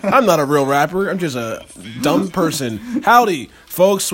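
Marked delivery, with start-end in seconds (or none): 3.0-4.1 slow hammed-up host greeting; show host greeting. Host opening the podcast, slow and hammy. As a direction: welcome the audience like a showman. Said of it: "opens the podcast show".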